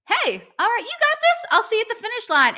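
high, loud, overly chipper encouragement; mock pep talk. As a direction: pump her up as you run past. Encouraging rival while running past.

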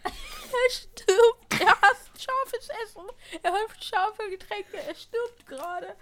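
high, loud gleeful taunting; gleeful teasing. Real, not acted. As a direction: crow with delight at his pain. Gleeful commentary on friend's pain.

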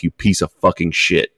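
normal talking voice

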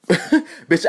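ordinary delivery

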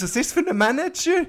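high, disbelieving outburst; how could the manager allow this; incredulous. Real, not acted. Friend mocks the film's manager in disbelief, incredulous.

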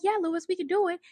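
high, soft, eager mock voice; a sugary mock reassurance to her husband; mock eager wife. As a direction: imitate her breezy promise. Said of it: "And she's like".

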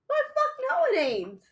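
high, indignant outburst; indignant exclamation. Retold or imitated. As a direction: squeak out the indignant no. Mock answer to coworker.

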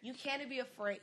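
ordinary talk, nothing marked

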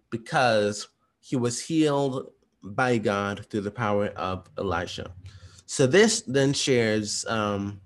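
ordinary talk

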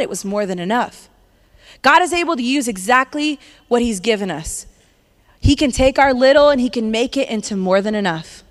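ordinary talk, nothing marked

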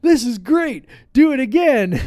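put-on high, loud, thrilled kid voice; excited kids. Kids cheering to dad after a close firework blast, high and loud. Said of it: "And the kids are like".

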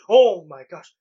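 loud startled gasp of words; taken aback. Acted. Priest learning who her visitor is, taken aback. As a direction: react with sudden awed surprise. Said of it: "she's taken back".

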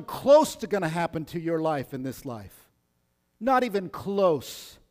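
loud, high fervent preaching; preaching at full force. Acted. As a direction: drive it home from the pulpit.

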